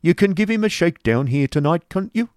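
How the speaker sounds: a bluff host laying down orders; Squeers' voice. A narrator's reading. Schoolmaster Squeers to new teacher, bluff and loud. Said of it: "said Squeers".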